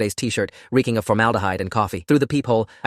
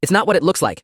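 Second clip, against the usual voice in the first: higher, urgent raised voice; mild shout; 8 semitones higher, 4 dB louder than usual. A narrator's reading. Tenant chasing his fleeing landlord, mild urgent shout. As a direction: call it out in protest, pitched up. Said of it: "I shouted, chasing after him".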